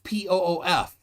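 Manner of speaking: P O O F spelled letter by letter. Shown as a demonstration. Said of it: "not poop".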